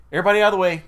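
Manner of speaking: retold curt order; rude order. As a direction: imitate a detective rudely clearing the scene. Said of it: "really rude".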